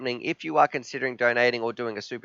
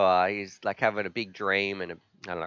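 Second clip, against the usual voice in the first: ordinary talk, nothing marked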